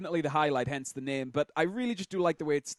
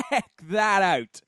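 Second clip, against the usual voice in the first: laughing; 5 semitones higher, 6 dB louder, much slower than usual. Real, not acted. Laughing at a silly replay.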